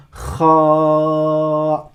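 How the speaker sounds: "Kha" with throaty kh, vowel held long; letter name. Shown as a demonstration. Teacher says the letter's name, "Kha" long and guttural. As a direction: rasp the start of "Kha", sustain a level a. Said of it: "Ça, c'est son nom".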